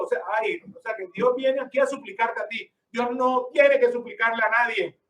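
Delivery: ordinary talk, nothing marked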